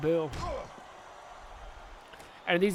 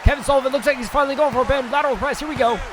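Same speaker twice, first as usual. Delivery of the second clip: excited play-by-play; 4 semitones higher than usual. Acted. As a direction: shout the big moves as they land. Live wrestling play-by-play.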